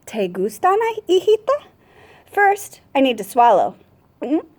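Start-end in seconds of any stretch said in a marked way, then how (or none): 0.1-1.6 high lilting question on hijita; grandma asking. A narrator's reading. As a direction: ask sweetly like a doting abuelita. Abuelita asks the child.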